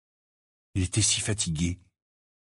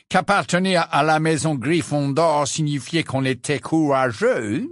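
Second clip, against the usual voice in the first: put-on sly old portrait; 3 semitones higher than usual. Portrait teasing Harry about Gryffindor courage, mocking and slow. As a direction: drawl the taunts like a smug old headmaster. Phineas Nigellus taunts Harry.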